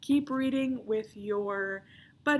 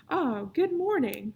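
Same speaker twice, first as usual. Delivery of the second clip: slow, polite, higher receptionist greeting; formal phone voice; 5 semitones higher, 6 dB louder than usual. Acted. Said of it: "sounding slightly more formal now".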